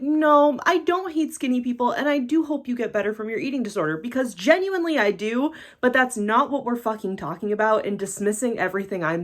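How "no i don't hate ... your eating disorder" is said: high-pitched placating self-quote; exasperated reassurance, pitched up; mock placating. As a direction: placate critics in a raised mock-earnest voice. Mimics herself placating critics.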